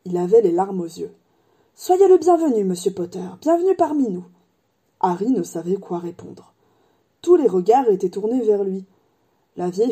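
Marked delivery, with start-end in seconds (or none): 1.7-4.2 high, loud burst of welcome, dipping on the name; effusive welcome. Acted. Barman greeting Harry Potter, overwhelmed and effusive welcome. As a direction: welcome an honoured guest effusively.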